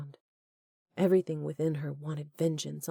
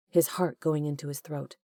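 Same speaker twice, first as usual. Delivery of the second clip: ordinary delivery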